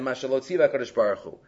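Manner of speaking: usual speaking voice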